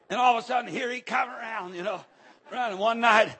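ordinary talk, nothing marked

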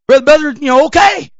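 ordinary delivery

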